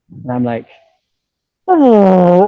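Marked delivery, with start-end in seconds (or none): 1.7-2.5 loud high shocked oh; a gut-punch groan of dismay. Retold or imitated. Hearing the buyer pull out, loud shocked oh. Sale falling through.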